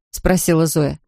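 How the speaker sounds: ordinary delivery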